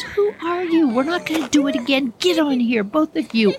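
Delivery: high, incredulous scolding; exasperated dad. Acted. Dad refusing his reckless son another run, high exasperation. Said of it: "he goes".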